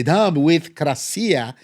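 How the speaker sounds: ordinary talk, nothing marked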